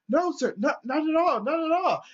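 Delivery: high, loud nervous apology; the cop backing down to the old man, high and loud; flustered cop. Retold or imitated. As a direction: back off in a hurry, voice up high. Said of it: "he's just like".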